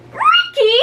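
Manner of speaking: very high, loud screech of his name. Acted. Jill screeches at Ricky, very high and loud. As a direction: shriek at him in outrage. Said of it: "screeched Jill".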